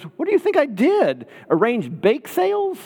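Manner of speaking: mock incredulous. Friend teasing Emily in story.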